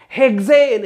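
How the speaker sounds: punchy classroom call; shouted drill. Shown as a demonstration. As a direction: call out hexane to lead a chant. Chants alkane against alkyl name.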